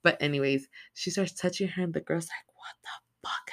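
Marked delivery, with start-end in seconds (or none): 0.9-2.5 hushed storytelling whisper. Real, not acted. Telling the creepy part hushed. 2.5-3.5 whispered, stunned what the fuck; shocked whisper. Retold or imitated. Girl whispering in shock at grandma's touch.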